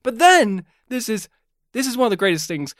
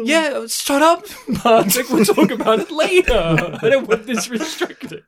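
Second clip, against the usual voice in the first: laughing; 4 semitones higher than usual. Real, not acted. Laughing while telling friend off.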